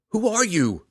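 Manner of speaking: high, loud, desperate shout; desperate, furious demand. A narrator's reading. Drizzt to his attacking father, desperate and furious. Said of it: "demanded desperately, furiously".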